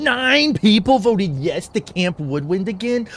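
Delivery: rising to a high loud yelp; outraged disbelief. Real, not acted. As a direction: squawk at chat for voting yes. Outraged at poll result.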